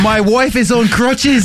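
high, drawn-out mock British voice; British tourist. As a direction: stretch it out in parody British. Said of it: "old guy with a British accent".

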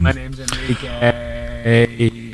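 slow flat robotic voice. Pretend. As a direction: speak like a stiff machine. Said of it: "you gotta do it like".

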